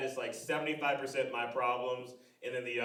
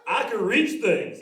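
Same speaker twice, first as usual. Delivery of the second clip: slow, louder, higher mock voice of a lanky guy; dopey tall guy; 8 semitones higher, 12 dB louder, slower than usual. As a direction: drag it out like a goofy giant.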